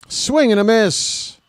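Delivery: slow, high, ringing call of the strikeout; drawn-out triumphant broadcast call; excited strikeout call. Real, not acted. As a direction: stretch it out like a big-moment announcer.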